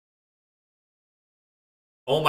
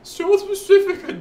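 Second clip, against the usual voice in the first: mock grand South Pacific; 5 dB louder, slower than usual. Mocking the destination name.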